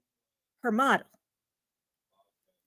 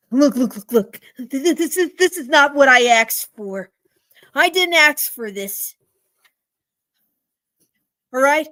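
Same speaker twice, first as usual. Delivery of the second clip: louder, indignant customer complaint; angry customer; 6 dB louder than usual. Acted. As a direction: push back hard at them.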